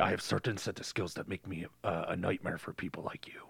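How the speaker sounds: low, soft menacing threat; menacing whisper. Retold or imitated. Father threatening the kidnapper on the phone. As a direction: threaten softly. Famous movie line quoted.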